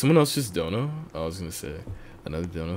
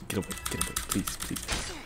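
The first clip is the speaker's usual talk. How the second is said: whisper; 2 semitones lower, 13 dB quieter than usual. Real, not acted. Hiding from the murderer.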